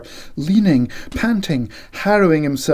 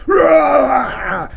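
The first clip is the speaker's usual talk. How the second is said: high loud yelled roar; 10 semitones higher, 5 dB louder than usual. Acted. McX roaring at the door to scare the rat. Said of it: "Snarls and yells, loud, foolish".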